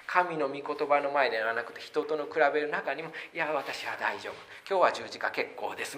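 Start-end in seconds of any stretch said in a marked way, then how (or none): 3.3-6.0 mock dismissive. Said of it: "みたいな".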